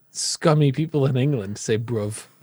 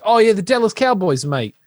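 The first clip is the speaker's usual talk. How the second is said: higher-pitched mock British fan; British accent; 7 semitones higher than usual. Imitating a British person talking to an American, mock British accent. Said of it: "when you meet a British person".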